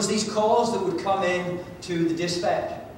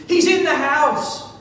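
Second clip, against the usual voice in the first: mock scream, higher and louder; retold scream; 8 semitones higher, 5 dB louder, slower than usual. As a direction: cry out the alarm as a terrified woman. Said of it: "the lady screamed".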